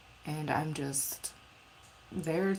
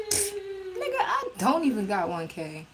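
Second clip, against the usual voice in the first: laugh-crying; 9 semitones higher, slower than usual. Real, not acted. Laughing at viewer's follower count.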